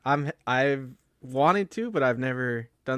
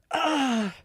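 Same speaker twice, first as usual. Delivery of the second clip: high, drawn-out "ahh" of frustration; pent-up road-rage groan, re-enacted; exasperated groan; 11 semitones higher than usual. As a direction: let out a high frustrated cry, retelling the moment. Retells his angry exasperation at driver.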